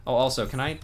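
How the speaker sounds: ordinary talk, nothing marked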